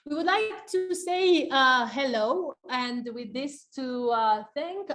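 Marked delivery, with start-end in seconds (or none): none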